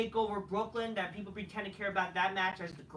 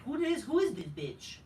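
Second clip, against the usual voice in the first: mocking quote; 6 semitones higher than usual. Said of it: "nobody fuck is like".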